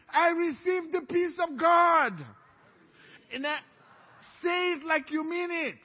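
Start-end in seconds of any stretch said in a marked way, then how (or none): 0.1-2.1 slow, loud and shouted; loud declaration. Shown as a demonstration. Pastor leads the congregation in a slow loud shout. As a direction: proclaim it to the whole room. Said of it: "Say with me".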